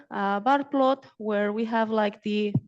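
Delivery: usual speaking voice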